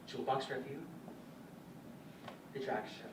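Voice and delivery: usual speaking voice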